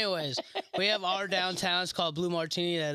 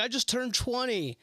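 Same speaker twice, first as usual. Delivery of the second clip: pitched-up crowd announcement; mock onstage reveal, higher; stage announcement; 9 semitones higher than usual. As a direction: tell the crowd, voice lifted high.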